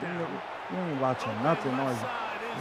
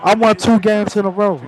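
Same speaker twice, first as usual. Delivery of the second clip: loud boast; 4 semitones higher, 13 dB louder than usual. Acted. Boasting at opponent.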